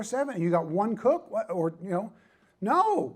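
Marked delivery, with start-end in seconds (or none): 2.6-3.1 high, loud overruling cry; acted firm refusal. Restaurant expert overruling an overstretched owner, acted firm refusal. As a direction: cut the owner off, high and emphatic.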